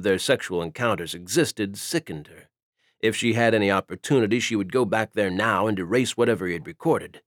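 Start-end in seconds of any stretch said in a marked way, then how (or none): none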